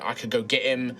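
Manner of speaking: ordinary talk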